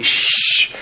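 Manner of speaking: long, sharp unvoiced shush; crowd's shush. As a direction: shush him as the disapproving crowd. Crowd hushing the blind man.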